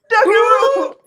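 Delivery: high-pitched squawked-out call; mock action cry. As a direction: call it out high, half laughing. Re-enacting her jump from car.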